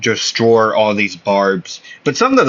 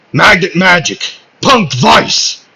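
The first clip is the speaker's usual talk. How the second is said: loud, high battle cry; spell-casting shout; 8 semitones higher, 6 dB louder than usual. Acted. Asta unleashing his magnet magic, shouted battle cry. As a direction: call out each attack with force.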